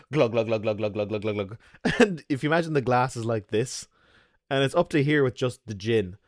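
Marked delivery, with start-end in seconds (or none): 0.1-1.6 a playful imitated glug sound; pouring glugs. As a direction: mimic liquor pouring freely.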